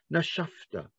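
Nashafta breathed out softly; breathy exhale. Shown as a demonstration. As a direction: let the breath puff through "Nashafta". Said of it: "and you puffed with your breath".

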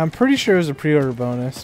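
normal talking voice

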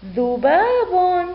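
ذباب joined as one slow, high word; teacher's drawn-out whole-word model; blended word reading. Shown as a demonstration. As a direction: read dhubaabun unbroken. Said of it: "لنقرأ".

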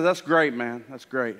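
soft fatherly caution; gentle warning. Acted. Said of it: "Jesus says".

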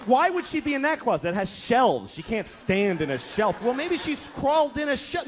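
high-pitched, exasperated bickering; arguing. Retold or imitated. Couple fighting over where their kid is hiding, exasperated arguing. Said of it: "Now we're having a fight".

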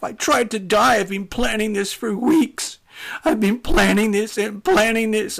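high, loud, tearful raging; blubbering anguish. Retold or imitated. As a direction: act out a drunk, despairing man wailing. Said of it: "whimpering and blubbering".

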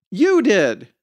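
put-on high child's retort, falling at the end; high little-girl comeback; bickering six-year-old. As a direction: fire back like a squabbling little kid. Twins bicker back and forth.

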